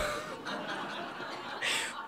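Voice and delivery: airless silent laugh; breathless laugh. Acted. Narrator collapsing into laughter at the find, breathless laugh.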